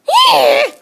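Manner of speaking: high, loud yelped yeah; high startled shout. Real, not acted. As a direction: yelp it out. Yelping at the dog.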